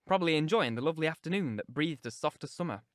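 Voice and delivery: ordinary delivery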